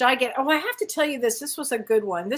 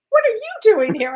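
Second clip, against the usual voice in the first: surprised father; 11 semitones higher, 7 dB louder than usual. Retold or imitated. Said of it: "he said".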